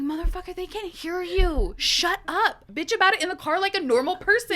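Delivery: loud, high, emphatic telling-off; exasperated scolding. Retold or imitated. Wife to loud complaining husband, mock-exasperated scolding. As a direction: scold in a high, forceful burst.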